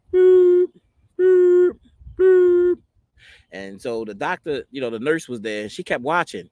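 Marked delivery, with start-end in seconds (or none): 0.1-2.8 long, high, loud boop; sustained tones like a monitor alarm; imitated dropping monitor tone. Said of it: "then it would just drop like".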